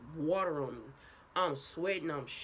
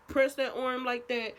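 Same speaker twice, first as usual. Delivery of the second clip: normal talking voice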